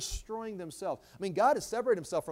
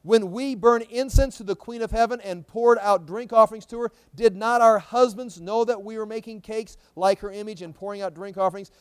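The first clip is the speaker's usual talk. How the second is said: loud, raised-pitch Bible proclamation; forceful scripture reading; 4 semitones higher, 10 dB louder than usual. Acted. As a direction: proclaim the verse loudly to the congregation. Reads scripture aloud to congregation.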